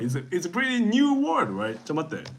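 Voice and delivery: usual speaking voice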